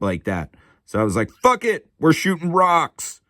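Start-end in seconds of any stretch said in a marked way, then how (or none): 1.3-3.2 mock exclamation. Retold decision, voiced quote.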